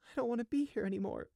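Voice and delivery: high, fast, small voice. A narrator's reading. Grieving sheep gives up, in a small voice. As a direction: make the voice tiny and high. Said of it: "he said in a small voice".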